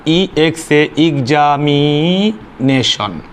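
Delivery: Examination very slowly, syllable by syllable; syllable breakdown. Shown as a demonstration. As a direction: stretch out each syllable of examination for the class. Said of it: "উচ্চারণ করতে চারবার অথবা তার চেয়ে বেশি সময় লাগবে". (এক্সামিনেশন টেনে টেনে, ভেঙে ভেঙে উচ্চারণ)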